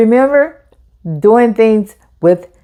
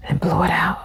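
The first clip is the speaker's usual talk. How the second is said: whispered breath out; 8 dB quieter than usual. Shown as a demonstration. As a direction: breathe the words out in a soft whisper. Teacher acts out breathing exercise.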